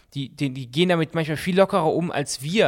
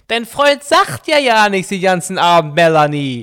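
high, booming, drawn-out parent voice; hammed-up loud in-law; 5 semitones higher, 11 dB louder, slower than usual. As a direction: complain loudly as the girlfriend's parent. Plays girlfriend's parent about the silent boyfriend. (überdrehter, lauter Schwiegerelternteil)

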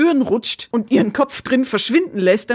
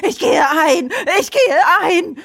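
hammed-up screeching in imitation of the witch; mock screaming; 9 semitones higher, 3 dB louder than usual. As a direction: shriek it like a melodramatic dying witch. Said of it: "wie am Spieß zu schreien".

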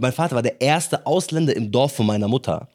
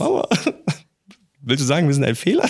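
laughing mock hurt; 3 semitones higher, faster than usual. Teasing mother over coffee.

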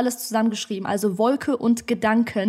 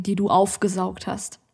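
usual speaking voice

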